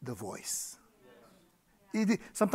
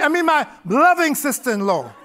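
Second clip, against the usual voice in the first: higher, louder teasing self-correction with a laugh; mock correction; 8 semitones higher, 6 dB louder than usual. Real, not acted. Fixing his jab at his sister-in-law, laughing and teasing. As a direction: cheekily take back the insult, laughing. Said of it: "oh, I mean".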